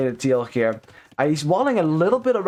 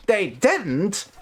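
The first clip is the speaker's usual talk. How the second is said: mock surprise; 5 semitones higher than usual. Twitch didn't notify the viewer.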